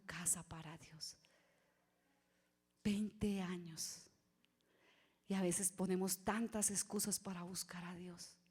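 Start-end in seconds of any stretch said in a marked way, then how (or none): 2.8-4.1 whispered and drawn out; slow whisper. Real, not acted. Preacher whispering the twenty years to the congregation. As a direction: whisper it slowly for weight. Emphatic hushed aside.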